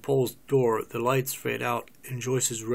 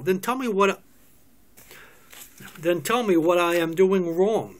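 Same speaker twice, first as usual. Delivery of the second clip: pleading, rising to a loud demand; raised demand; 5 semitones higher, 4 dB louder than usual. Acted. Lover begging Paul to say what's wrong, raised pleading voice. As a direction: push him for an answer, louder each time.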